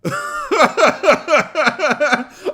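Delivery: loud, high cackling fit; piercing burst of laughter; hysterical laugh. Real, not acted. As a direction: laugh helplessly at top pitch. Laughing at the missed joke.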